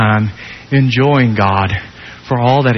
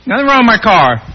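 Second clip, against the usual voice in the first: high, insistent defensive voice; stubborn car owner; 10 semitones higher, 4 dB louder than usual. Retold or imitated. Preacher voicing a proud owner, insisting. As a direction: play the proud owner brushing it off.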